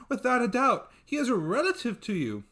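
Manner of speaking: high, eager pleading voice; insistent servant girl. Acted. Servant insisting to her mistress, high and eager. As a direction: she is sure and wants to be believed.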